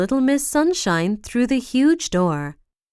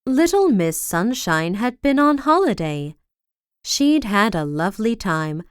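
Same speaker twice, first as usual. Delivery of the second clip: ordinary delivery, nothing marked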